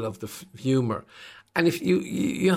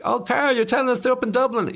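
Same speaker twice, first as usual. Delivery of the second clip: high, fast mock radio host; 12 semitones higher, 3 dB louder than usual. Mocking the radio host live on air, high and fast. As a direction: mimic the host high and quick. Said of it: "so I was like".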